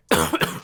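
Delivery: abrupt throat-catching cough; choking cough. Real, not acted. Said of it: "I'm just choking on my embarrassments".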